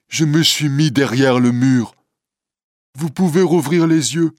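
a lightly voiced Quasimodo reassuring Esmeralda; gentle hunchback. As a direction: soothe her like a shy giant.

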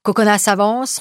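ordinary delivery, nothing marked

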